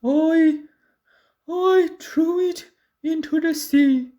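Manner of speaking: a troll caught out by pirates; nervous stammering troll; frightened troll. A narrator's reading.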